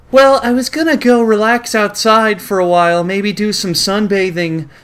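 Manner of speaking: high, airy-headed character voice; high-pitched mock innocence; put-on comic woman's voice. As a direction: read her line high and affected. Voicing the woman being ogled.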